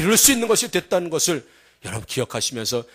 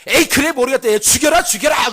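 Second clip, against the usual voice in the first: high, heated wife fighting back; acted enraged dare; defiant outburst; 8 semitones higher, 3 dB louder than usual. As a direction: shout back recklessly after being hit. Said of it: "이렇게 대들다가".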